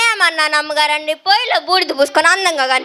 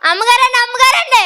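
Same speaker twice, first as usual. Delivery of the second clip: loud call; 8 semitones higher, 4 dB louder than usual. Acted. Maid calls out to the mistress again.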